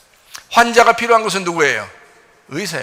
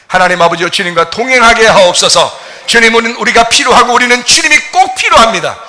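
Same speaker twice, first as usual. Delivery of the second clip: loud, earnest prayer to God; fervent prayer; 3 semitones higher, 10 dB louder than usual. Real, not acted. Pastor leading the congregation in prayer, loud and fervent. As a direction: pray aloud with full conviction.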